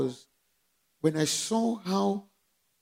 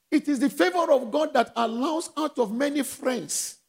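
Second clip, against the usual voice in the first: ordinary talk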